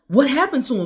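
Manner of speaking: panicked demand for answers, retold; freaking out. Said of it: "I'm freaking out at this point".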